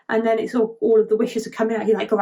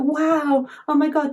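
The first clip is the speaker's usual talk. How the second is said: acted amazement; 6 semitones higher than usual. Revived star marvels while floating.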